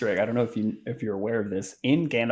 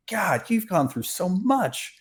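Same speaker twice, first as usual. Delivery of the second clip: high, slow gushing sympathy; a put-on reverent voice, pitched up; mock awe; 10 semitones higher, slower than usual. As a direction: coo it like an awed fan. Mock awed reply to Gandalf.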